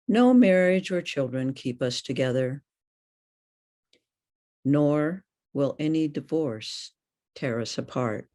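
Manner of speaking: normal talking voice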